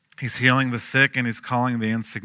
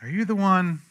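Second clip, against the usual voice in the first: doubtful plea; 7 semitones higher than usual. Retold or imitated. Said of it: "That's a loaded question".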